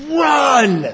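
high, loud shouted command; urgent shout. Real, not acted. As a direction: shout the congregation out of sin. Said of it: "are you in a place of sin".

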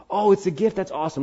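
high, bright fake excitement over a gift; playing a person gushing over a gift; mock delight. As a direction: act thrilled at the present.

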